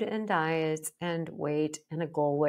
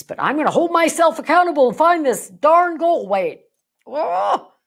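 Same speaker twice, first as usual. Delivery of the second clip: much higher, loud hammed-up self-scolding; mock exasperated outburst; 10 semitones higher, 10 dB louder than usual. Dieter berating herself over the goal weight, loud mock exasperation. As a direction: blow up at yourself in mock frustration, high and loud.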